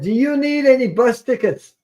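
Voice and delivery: high, loud retold offer; calling out. Imitating a stranger offering bus tickets, calling out high. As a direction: pitch it up as if hailing someone.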